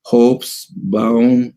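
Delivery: Hobsbawm broken into two slow syllables, "Hobs-baum"; careful dictation. Shown as a demonstration. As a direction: sound out Hobsbawm slowly for someone writing it down. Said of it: "Hobsbawm se escribe".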